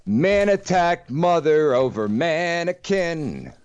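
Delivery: high, drawn-out announcer delivery; theatrical headline announcement. Acted. Announces the next story's headline theatrically.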